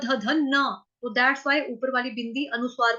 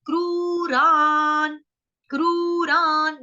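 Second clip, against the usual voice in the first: क्रूरान drawn out slowly, higher; slow demo; 3 semitones higher, 3 dB louder, much slower than usual. Shown as a demonstration. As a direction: stretch क्रूरान for students. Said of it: "क्रूर शब्द वैसे भी आसान है".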